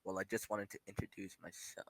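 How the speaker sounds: quiet normal voice. Acted. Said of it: "she's a normal person".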